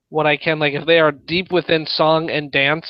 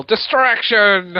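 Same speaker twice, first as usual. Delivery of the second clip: yelling; 5 semitones higher, 4 dB louder, much slower than usual. Acted. Yelling while throwing food.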